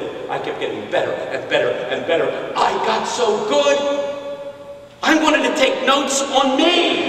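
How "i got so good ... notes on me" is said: high, drawn-out preacher crescendo; hammed-up boasting. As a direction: stretch it out in comic self-admiration. Mock boasting at sermon's peak.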